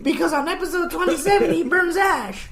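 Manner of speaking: high put-on child voice drifting into an accent; kid with accent. Little Jimmy explaining why he burned the house, high mock-kid voice. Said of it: "I don't know why he picked up an accent towards the end".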